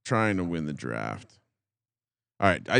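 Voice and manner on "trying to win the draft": mumbling quietly. Real, not acted. Mumbling under pressure on the clock.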